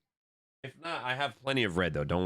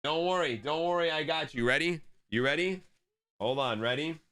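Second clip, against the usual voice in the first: higher, gentle reassuring voice; 6 semitones higher than usual. Real, not acted. Comforting daughter after spill.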